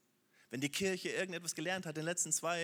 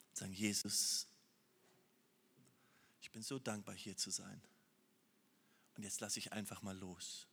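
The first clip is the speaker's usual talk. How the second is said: soft, low, hushed prayer to Jesus; quiet prayer; 7 semitones lower, 7 dB quieter than usual. Acted. Preacher voicing a hushed, intimate prayer to Jesus. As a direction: pray it softly, almost to yourself.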